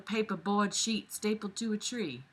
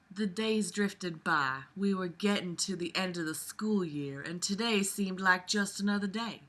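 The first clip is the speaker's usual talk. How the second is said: Southern Belle; 4 dB quieter than usual. Acted. Narrator opening the chapter by listener request, Southern Belle accent. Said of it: "I'm gonna start with Southern Belle".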